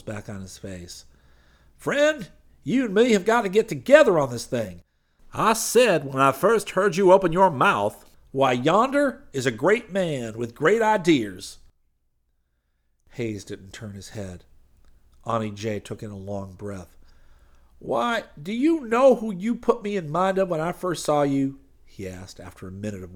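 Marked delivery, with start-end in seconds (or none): 1.8-11.6 loud high preacher voice; butter him up, loud; flattering preacher. Acted. Preacher flattering Hayes in the car, loud and high. 17.8-21.6 loud, high glad-handing preacher voice; high preacher figure. Acted. Street preacher pitching to the crowd, loud and high.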